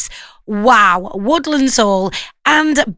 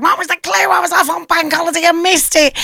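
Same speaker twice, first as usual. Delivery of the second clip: higher-pitched mock listener whinge; complaining listeners; 7 semitones higher than usual. Radio host mimics listeners who missed the clue, mock complaining. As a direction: put on a higher voice, imitating people pestering the show. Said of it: "I'll get a load of people going".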